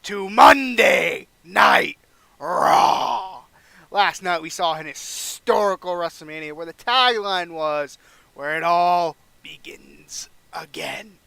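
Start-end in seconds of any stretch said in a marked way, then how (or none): 0.0-3.4 much louder, slow drawn-out arena shout, higher; Vince McMahon announcing. Pretend. Boss on the mic welcoming the arena, big shout. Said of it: "grabs a mic and says". 8.3-11.2 slow, hushed whisper of the tagline; dramatic whisper. Pretend. Boss recalling the WrestleMania tagline, slow whisper.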